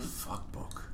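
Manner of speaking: whispers Fuck book with no voice at all. Acted. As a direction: breathes Fuck book under the breath, no voice. Whispered repeat.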